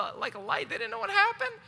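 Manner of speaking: ordinary talk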